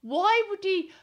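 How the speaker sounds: high, loud, incredulous outburst; indignant why, pitched right up; outraged. Real, not acted. Columnist railing at her husband's confession, outraged.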